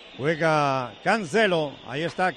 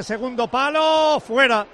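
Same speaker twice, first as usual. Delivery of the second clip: excited call; 5 semitones higher, 5 dB louder, slower than usual. Real, not acted. Near miss at goal called out.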